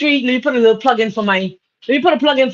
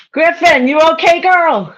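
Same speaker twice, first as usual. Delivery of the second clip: excited hello; 7 semitones higher, 4 dB louder than usual. Real, not acted. Greeting friend in chat.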